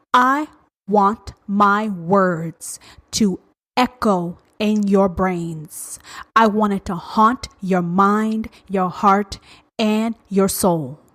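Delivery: drawn-out, weighty delivery with spaces between words; slow and deliberate, meant to linger; slow, haunting emphasis. Real, not acted. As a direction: let each word sink in slowly. Wants words to echo and haunt.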